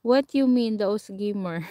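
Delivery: usual speaking voice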